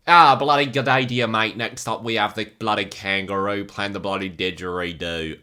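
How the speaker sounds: loud, hammed-up Aussie bloke accent; a cheesy overdone Australian accent; a jokey, exaggerated Australian accent; bad Australian accent. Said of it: "I won't do an Australian accent again because that's not very good".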